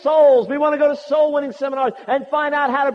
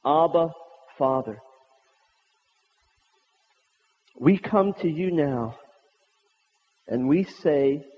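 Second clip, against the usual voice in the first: prayer; 8 semitones lower than usual. Acted. Opening prayer, soft and low.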